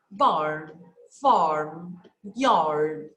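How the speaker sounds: teacher modelling unstressed R; light R model. Shown as a demonstration. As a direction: show that the R is not stressed. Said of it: "the R is not ... in the pronunciation".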